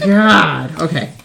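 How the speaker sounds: strained exclamation under a heavy load; lifting something heavy; straining grunt. Real, not acted. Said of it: "this is heavy".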